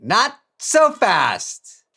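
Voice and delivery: slow, high, loud game-show cry; mock announcer. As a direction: stretch it out like a cheesy TV host.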